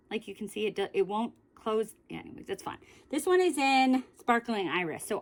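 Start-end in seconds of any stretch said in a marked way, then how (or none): 2.1-2.8 breathy whisper, almost to herself; whispered aside. Real, not acted. Muttering to herself about the broken closure, whispered.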